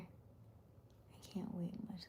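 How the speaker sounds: barely voiced, slow whisper; reading a chat comment in a slow whisper. Real, not acted. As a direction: breathe the words out slowly, no voice.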